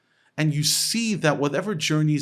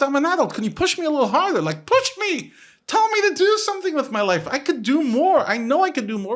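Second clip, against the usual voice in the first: very high, loud impassioned plea; worked-up, high-pitched protest; exasperated demand; 13 semitones higher, 6 dB louder than usual. Retold or imitated. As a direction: beg loudly to be pushed harder. Retells his plea to be pushed.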